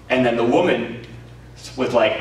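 ordinary talk, nothing marked

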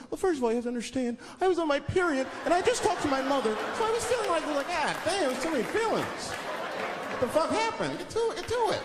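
high, fast, mock woman telling a long story; imitating a woman's story. Comic mocking a woman who rambles about feelings, high voice. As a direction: ham up a rambling girlfriend, high and rushed. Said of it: "Women tell stories, all these feelings".

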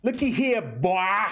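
put-on "boy" drawled with a W glide; southern drawl. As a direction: holler it like a southern drill sergeant. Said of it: "You gotta give it that, that southern ugh".

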